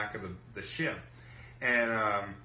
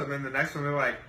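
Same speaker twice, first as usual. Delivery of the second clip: ordinary delivery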